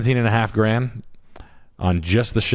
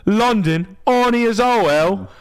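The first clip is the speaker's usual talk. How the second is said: high, fast hammed-up Cockney accent; a cartoon Cockney bloke, much higher than normal; 12 semitones higher than usual. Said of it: "Cockney accent".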